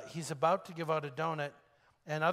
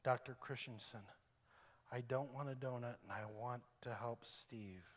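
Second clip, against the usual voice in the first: tearful plea; 4 semitones lower, 11 dB quieter than usual. Retold or imitated. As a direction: speak through held-back tears. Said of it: "says with tears on her face".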